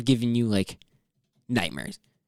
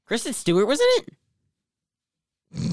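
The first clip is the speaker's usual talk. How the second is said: surprised; 8 semitones higher, much slower than usual. Real, not acted. Surprised discovery.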